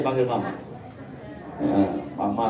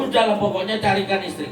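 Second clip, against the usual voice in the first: impatient insistence; 8 semitones higher, faster than usual. Acted. Insistent demand.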